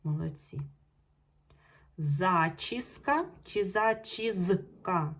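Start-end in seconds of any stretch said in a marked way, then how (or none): 2.0-5.1 "Зачіска чи зачізка" very slowly, с set against з; teacher comparing the two spellings; slow contrast. Shown as a demonstration. Teacher to pupils, slowly comparing spellings. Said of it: "Чути звук С".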